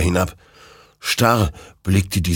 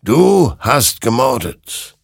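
slow, higher, louder judgment; grim verdict; 6 semitones higher, 5 dB louder, slower than usual. A narrator's reading. Creature condemning Hector, slow and grim. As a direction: condemn him flatly. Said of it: "creature pronounces verdict".

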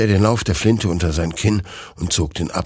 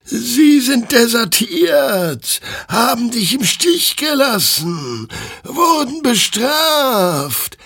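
very high, very slow otherworldly chorus; drawn out like a choir of burning souls; flaming souls in chorus; 4 dB louder, slower than usual. Acted. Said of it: "erklärten die flammenden Seelen ihm im Chor". (hoch, sehr langsam, wie ein Chor flammender Seelen)

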